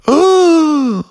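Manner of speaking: high, loud sympathetic ooh; group wince. Acted. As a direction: wince at the sight. Crowd sees his mouth.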